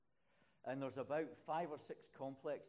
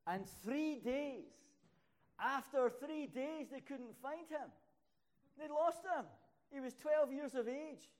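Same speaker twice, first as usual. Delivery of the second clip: preaching at full force; 11 semitones higher, 10 dB louder than usual. Acted. Preacher to congregation, crying out they lost Jesus, full-force preaching. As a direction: build it up to a thundering sermon peak.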